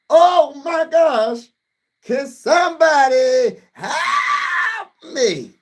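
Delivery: high, slow mock cry for help; mock overwhelmed. Streamer overwhelmed by Hurts stats, hammed-up cry for help. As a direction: ham up being overwhelmed.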